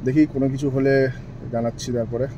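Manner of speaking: hushed and low so the police won't hear; quiet, hidden commentary; speaking softly. Real, not acted. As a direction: keep it low, almost under your breath. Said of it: "আস্তে আস্তে বলছি".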